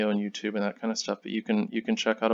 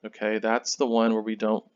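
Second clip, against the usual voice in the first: ordinary delivery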